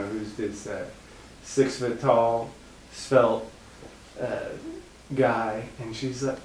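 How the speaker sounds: usual speaking voice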